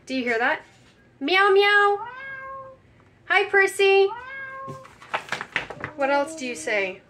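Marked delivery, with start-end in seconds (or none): 1.2-4.1 high, sing-song call to a kitty; high pet talk. Pretend. Trying to get the toy cat to respond, high pet talk. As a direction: coo to a pet in a raised voice.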